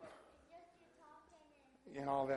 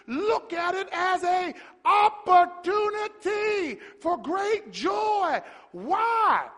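full-force preaching; 13 semitones higher, 6 dB louder than usual. Real, not acted. Preacher urging brothers and sisters through trouble, full-force preaching.

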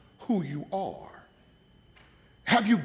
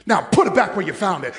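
loud command; 8 dB louder, faster than usual. Retold or imitated. Orders devil to return it.

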